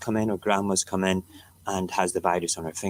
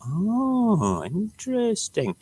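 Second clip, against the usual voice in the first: high, drawn-out swooping "ooh, interesting"; lilting high mock fascination; mock intrigue; 12 semitones higher, much slower than usual. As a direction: react with exaggerated, slow curiosity. Mock reaction to a headline.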